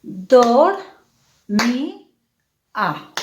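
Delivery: "dor, mi, a" as three separate beats; split into syllables. Shown as a demonstration. Teacher applying the hiatus rule for pupils, syllable by syllable. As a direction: say "dor, mi, a" with a pause after each syllable. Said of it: "Entonces digo".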